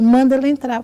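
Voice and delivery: loud, high retold order; calling out. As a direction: call it out to the secretary. Doctor calls out to secretary.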